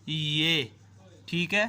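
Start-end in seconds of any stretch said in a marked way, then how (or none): 0.1-0.6 long-vowel demonstration of "یہ"; full-length "یہ". Shown as a demonstration. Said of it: "اس میں ہم پڑھیں گے پورا".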